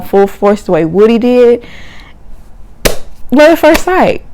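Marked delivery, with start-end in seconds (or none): 3.3-4.2 love said high and emphatic; dramatic punchline. Real, not acted. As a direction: land it like a big reveal. Dramatic repeat of the phrase.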